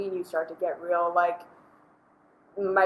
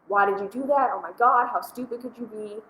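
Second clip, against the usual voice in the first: higher, louder mock outburst; acted angry tirade, raised pitch; yelling at cashier; 5 semitones higher, 5 dB louder than usual. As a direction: snap at them in exasperation, hammed up. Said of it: "You yell at them".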